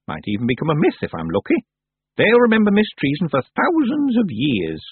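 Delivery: put-on old witch proudly pleased with her trick. A narrator's reading. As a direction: boast like a mischievous crone. Miss Treason boasting.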